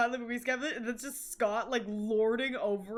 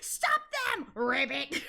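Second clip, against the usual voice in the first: imitated game villain; 2 semitones higher than usual. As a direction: squeal the command, then drop for the last word. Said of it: "my favorite is the Fate collab".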